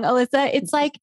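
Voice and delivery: ordinary talk, nothing marked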